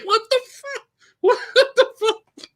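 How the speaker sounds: high, laughing what the fuck; high-pitched laughing disbelief; stunned disbelief. Retold or imitated. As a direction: gasp it out in amused shock. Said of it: "I was like".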